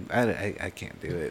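ordinary delivery

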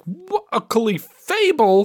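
high, drawn-out hammed-up surprise; mock astonishment. Podcaster mimicking a monster-of-the-week reveal, mock astonished. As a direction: act stunned, stretching it out high.

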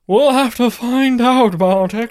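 high, loud spooky host voice. Acted. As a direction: ham it up like a horror host. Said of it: "Spooky voice there, [name]".

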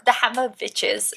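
put-on comic German accent on the book title. Host repeating the book title for co-host, playful German accent. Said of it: "You gotta say it like that".

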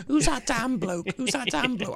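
high, puzzled mock voice; lightly voiced confused onlooker; mock viewer voice.

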